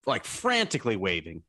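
normal talking voice

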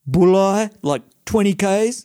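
high, slow, dopey Kiwi drawl; mock Kiwi stoner. [name] reading the road sign.

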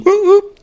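high, loud double whoop; celebratory high-pitched hoot; playful whoop. Real, not acted. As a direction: whoop it out in falsetto. Spontaneous playful whoop after joke.

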